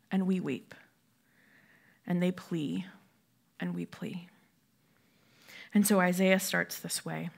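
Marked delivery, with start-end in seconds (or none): 0.1-0.8 whispered. Real, not acted. Whispered repetition of weeping. 2.1-4.3 fading plea. Real, not acted. Fading litany.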